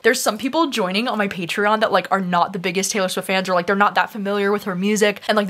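ordinary delivery

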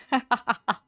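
short burst of giggling; giggle. Real, not acted. Streamer messing around with a word, quick giggle. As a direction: break into a quick laugh. Laughs at own mispronunciations.

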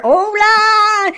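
hailing someone far off; calling out. Acted. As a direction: shout the name across a distance. Calling out to his conscience.

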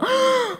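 sharp high-pitched acted gasp; horrified gasp. Pastor picturing a late entrance before everyone, acted horrified gasp. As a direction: gasp in dread at the thought.

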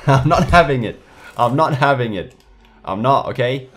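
loud, high angry shout; angry outburst. Real, not acted. At the rival car, a real angry shout. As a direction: yell it out in real fury. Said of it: "I'm getting pissed".

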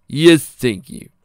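loud, drawn-out eager thanks; big enthusiastic acceptance. A narrator's reading. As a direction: accept eagerly, stretching it out high. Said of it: "nodded enthusiastically".

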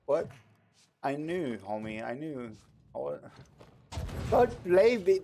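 normal talking voice

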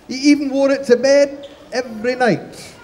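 ordinary delivery

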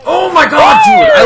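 loud, high outburst; exasperated shout. Real, not acted. Gamer reacting to the match, exasperated shout. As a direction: cry out in disbelief.

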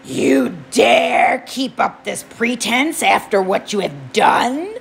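slow, booming furious god; angry god shout. Acted. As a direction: roar the accusation. Hades enraged, eyes bright.

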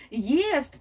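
usual speaking voice

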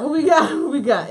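loud, high hype shout; pumped-up callout. Acted. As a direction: call it out to the crowd. Hyping up the live chat.

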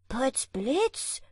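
astonished cry of the tailor; surprised exclamation. A narrator's reading. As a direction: blurt it out in wonder. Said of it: "rief der Schneider". (überraschter Ausruf)

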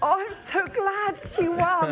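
high, slow, gushing praise; feigned gracious delight. Acted. Guest shows how she loses awards, hammed-up delight. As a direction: overdo the good loser's joy for a rival. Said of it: "I'm brilliant at going".

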